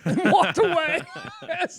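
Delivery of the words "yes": ordinary delivery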